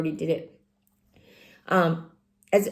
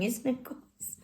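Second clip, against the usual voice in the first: usual speaking voice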